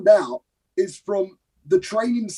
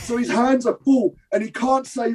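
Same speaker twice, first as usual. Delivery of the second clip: ordinary delivery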